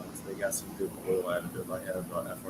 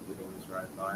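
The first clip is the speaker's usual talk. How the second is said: normal talking voice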